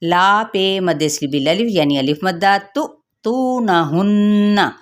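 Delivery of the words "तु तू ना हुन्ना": तु cut short with a jolt, then हुन्ना drawn; jerk on the hamza. Shown as a demonstration. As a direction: give a jerk on तु, then recite on. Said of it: "यहाँ पे हम झटका देंगे".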